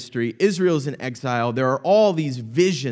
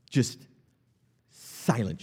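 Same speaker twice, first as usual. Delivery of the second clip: dropping to a hush; 5 semitones lower, 7 dB quieter, slower than usual. Acted. Preacher hushing the congregation, quiet and still. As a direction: let the voice fall away to near silence. Picturing heaven hushed.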